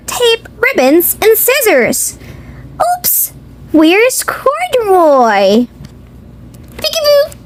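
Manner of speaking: usual speaking voice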